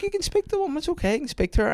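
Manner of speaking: high coaxing voice urging daughter to talk; coaxing a child. Retold or imitated. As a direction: sweet-talk the shy child into answering. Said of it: "I went".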